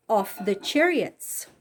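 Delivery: ordinary delivery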